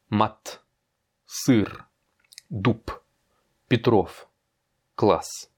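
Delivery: each word alone, ending on a hard consonant; hard final consonants. Shown as a demonstration. Teacher reads the left column to a learner, hard endings. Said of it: "je vais te les prononcer d'abord à gauche".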